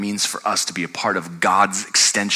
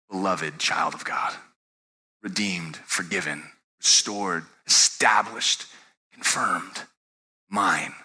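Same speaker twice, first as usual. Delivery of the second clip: low, soft, measured reassurance; tender declaration; 3 semitones lower, 3 dB quieter, slower than usual. Acted. God names his beloved child, tender quiet declaration. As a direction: let each word stand alone, gently and solemnly. God's words written on the heart.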